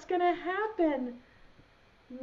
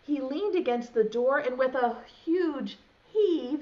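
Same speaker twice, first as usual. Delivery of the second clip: usual speaking voice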